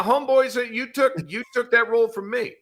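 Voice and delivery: usual speaking voice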